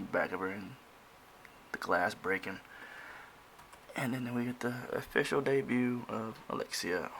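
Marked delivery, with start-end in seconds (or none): none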